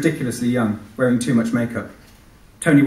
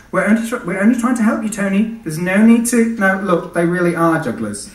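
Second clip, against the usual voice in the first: put-on high, louder young policewoman; 9 semitones higher, 4 dB louder than usual. Policewoman calming Tony, high reassuring voice. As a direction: soothe him in a high bright voice.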